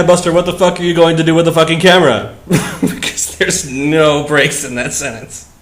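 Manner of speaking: ordinary delivery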